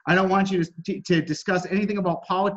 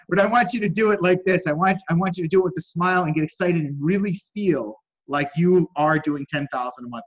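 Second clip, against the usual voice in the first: usual speaking voice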